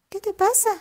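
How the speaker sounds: voz de zorra aguda y viva, más alta; zorra astuta. Acted. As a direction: una zorra lista que pregunta con curiosidad. (la zorra pregunta al campesino asustado, voz aguda y curiosa)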